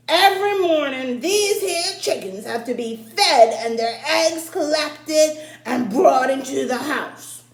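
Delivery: put-on loud, high old farmer voice; old farmer's orders. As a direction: boom the chores at the boy like a stern old farmer. Old farmer instructs in story.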